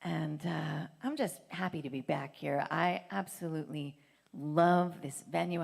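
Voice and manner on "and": ordinary delivery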